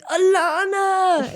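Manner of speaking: high, very drawn-out mock cry; long wailing retold call, not loud; strained garbled call. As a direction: imitate struggling to call out a name. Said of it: "look like I'm having a fucking stroke".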